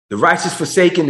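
ordinary talk, nothing marked

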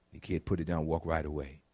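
usual speaking voice

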